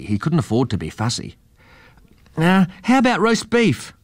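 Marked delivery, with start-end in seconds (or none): 2.4-3.9 put-on much higher, eager kid; a high young boy asking; boy's voice. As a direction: offer it up hopefully. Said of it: "asked Joe".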